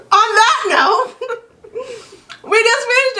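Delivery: words pushed out through laughter; high, giggling, nearly crying; laughing. Real, not acted. Bursting out laughing mid-sentence.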